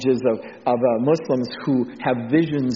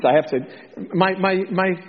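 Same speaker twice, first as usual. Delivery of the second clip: usual speaking voice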